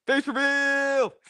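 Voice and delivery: high, loud, stretched-out cry; mock-grand announcement. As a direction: sing it out like a big reveal. Jokey announcement on camera.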